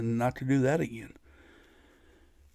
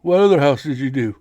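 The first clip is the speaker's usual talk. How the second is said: a louder, surprised uncle demanding answers; startled uncle; 7 dB louder than usual. Retold or imitated. Uncle hears about another house, surprised and louder. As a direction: push the volume up on what other house. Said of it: "And he goes".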